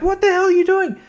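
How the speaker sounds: high indignant what-the-hell; shocked outburst. Retold or imitated. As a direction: squeal it in outraged shock. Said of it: "and I'm like".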